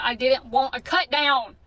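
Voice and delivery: insisting loudly she never wanted it; retold loud protest. Customer objecting to the nail tech, slow and loud. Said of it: "shouldn't have got loud with them".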